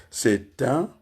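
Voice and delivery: very slow, long gaps between syllables. Shown as a demonstration. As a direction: deliver it like a slow dictation. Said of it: "Il y a trois syllabes là".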